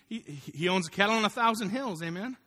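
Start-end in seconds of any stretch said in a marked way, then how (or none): none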